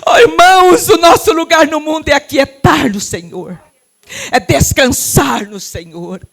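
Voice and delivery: loud, high, tearful plea; crying preacher. Real, not acted. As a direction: preach through the tears. Preaching with tears. (pregando chorando)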